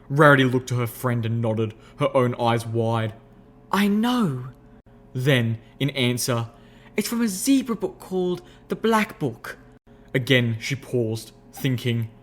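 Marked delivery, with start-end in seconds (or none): 3.7-9.6 high refined lady's voice, explaining; refined unicorn explaining. Acted. Rarity explaining soul jars, high refined voice.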